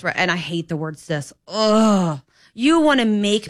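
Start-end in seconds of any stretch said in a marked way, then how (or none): 1.5-2.2 disgusted ugh. Real, not acted. Disgust at the word cis.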